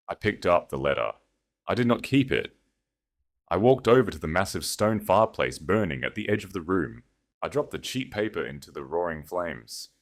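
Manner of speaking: ordinary delivery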